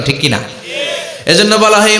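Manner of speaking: ordinary talk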